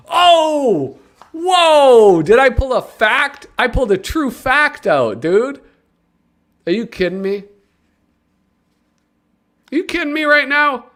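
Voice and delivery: high, loud excited whoop; excited squeal. Real, not acted. Tells the viewers, a thrilled high excited squeal. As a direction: squeal in delight at knowing a fact. Excited over pulling a fact.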